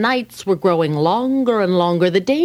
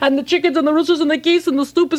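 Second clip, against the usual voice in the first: frantic poor man; 7 semitones higher, much faster than usual. Acted. Poor man frantically complaining to the rabbi. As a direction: rattle it all off in a panic.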